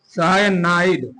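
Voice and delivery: Cyanide at half pace, for copying down; slow dictation. Shown as a demonstration. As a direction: draw out each syllable of cyanide. Teacher repeats it slowly for writing.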